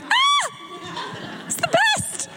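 very high squealed swoon; mock-swoon in a tiny high voice; hammed-up delight. Preacher acting out being whispered to, high mock delight. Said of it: "you're like".

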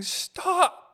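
high, loud exasperated cry; exasperated plea. Acted. Son to overbearing Italian mother, exasperated high cry. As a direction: burst out high, begging for an end.

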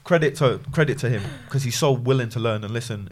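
ordinary talk

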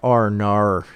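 exaggerated Aussie "oh no" with ar-coloured vowels; Australian accent. Shown as a demonstration. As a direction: demonstrate a broad Australian accent. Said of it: "Australians are more like".

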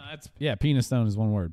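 ordinary talk, nothing marked

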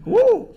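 high, short "woo"; excited whoop. Real, not acted. Preacher after asking for an amen, excited whoop.

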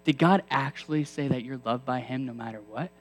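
low, soft whispering tempter. Acted. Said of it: "He might say".

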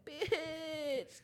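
high, drawn-out weepy cry; mock tearful. Host reacting to a moving quote, hammed-up tearful wail. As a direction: stretch it out as if overcome with tears.

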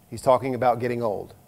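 usual speaking voice

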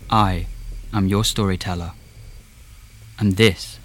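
usual speaking voice

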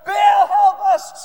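full-force high shout, long drawn out; all-out acted bellow. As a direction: yell the plea at the top of your voice. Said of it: "And louder".

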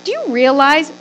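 usual speaking voice

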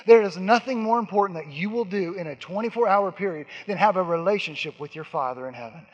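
ordinary talk

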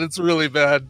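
usual speaking voice

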